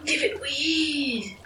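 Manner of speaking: slow, soft, odd little rat voice; rat impersonation. Pretend. Player tries her best rat voice, slow and soft. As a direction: squeak it out slowly like a stoned rat. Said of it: "do my best rat impersonation".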